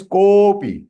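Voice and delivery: kopi with a long sustained "ko"; three-beat extension. Shown as a demonstration. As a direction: hold the o of kopi for three beats, then drop to "pi". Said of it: "Three times".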